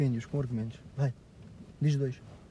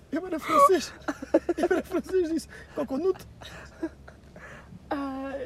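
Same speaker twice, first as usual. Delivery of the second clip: laughing through story; 12 semitones higher than usual. Retold or imitated. Retelling ice-cream shop mix-up.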